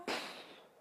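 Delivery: soft, voiceless puff of air; venting puff. Shown as a demonstration. Showing how saying it relieves anger, venting puff. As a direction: blow the anger out in one breath.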